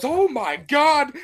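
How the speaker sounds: slow, high, loud "oh my God" of wonder; amazed gasp. Retold or imitated. Andrew Garfield stunned by Toby's webs, slow amazed gasp. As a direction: stretch it out, stunned. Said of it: "Andrew Garfield goes".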